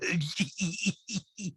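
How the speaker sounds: high, put-on pulsing giggle; Rinna-esque giggle. Host ends his mock reality star, high imitation giggle. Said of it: "the laugh is kinda Rinna-esque".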